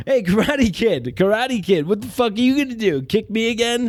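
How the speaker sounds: mock him like a schoolyard bully; taunting thug. Italian Karate Kid taunting the old Karate Kid, jeering.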